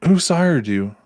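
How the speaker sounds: high, loud question; startled demand. Acted. Prince asks Eddie who sired him, startled. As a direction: break off and ask, pitch leaping up.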